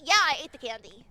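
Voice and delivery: ordinary talk, nothing marked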